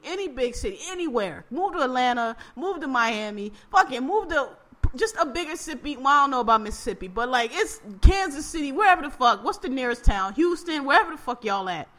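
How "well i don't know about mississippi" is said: ordinary talk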